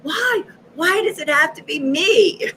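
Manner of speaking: high, loud protesting whine; mock tantrum. She protests her mission to God, mock tantrum. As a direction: throw a hammed-up tantrum at the unfairness. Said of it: "like, the whole tantrum".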